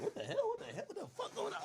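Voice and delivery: high, soft, laughing "what the hell"; breathless high-pitched disbelief with a laugh; stunned disbelief. Retold or imitated. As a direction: squeak it out in shocked disbelief, half laughing. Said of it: "I'm like".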